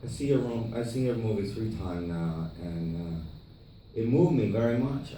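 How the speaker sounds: lightly imitated foreign filmmaker, subdued; Tommy. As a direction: softly mimic his halting delivery. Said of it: "he's like".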